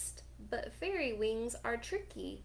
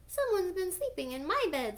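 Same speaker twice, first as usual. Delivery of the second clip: put-on higher baby bear squeal; wee little voice; 9 semitones higher, 4 dB louder, faster than usual. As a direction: squeal it like a tiny cub. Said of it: "squealed Baby Bear in his wee little voice".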